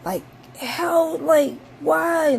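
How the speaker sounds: high, loud exasperated outburst; livid. Retold or imitated. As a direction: rant at the bird in disbelief. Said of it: "I was mad. I was livid".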